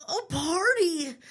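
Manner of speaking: high, loud, drawn-out cheer; excited shout. Acted. Blueberry suggests tonight's plan, shouting excitedly. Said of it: "he shouts".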